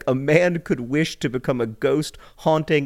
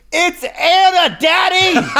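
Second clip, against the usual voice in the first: put-on high, slow shrieking little girl; toddler scream; 7 dB louder, much slower than usual. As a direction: scream it like a three-year-old. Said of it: "recreate Alice's voice because she does not speak, she only screams".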